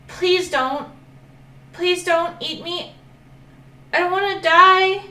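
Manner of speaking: high, rising plea ending in a loud cry; frightened begging. Acted. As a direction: panic growing with each please. Dragon begs not to be eaten.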